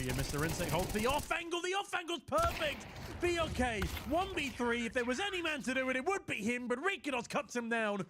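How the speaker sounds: high-pitched, full-throttle esports call, easing slightly towards the end; excited play-by-play. Real, not acted. Caster calling a last stand, excited play-by-play. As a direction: cry out the big play, pitch soaring.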